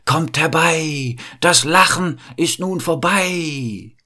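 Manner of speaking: high, slow witch's chant, stretching the vowels; old witch's incantation. A narrator's reading. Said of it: "murmelte dabei wieder".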